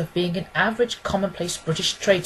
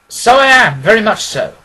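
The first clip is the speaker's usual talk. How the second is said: a louder, higher Holmes voice greeting warmly; cordial Holmes; 4 semitones higher, 10 dB louder than usual. A narrator's reading. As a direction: welcome your old friend in with open arms. Holmes answers Watson.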